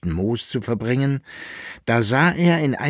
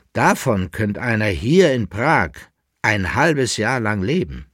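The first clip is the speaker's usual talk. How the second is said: ordinary delivery, nothing marked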